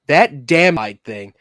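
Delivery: slow, loud, fed-up grumble; frustrated. Real, not acted. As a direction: curse at the broken computer. Host angry at the computer.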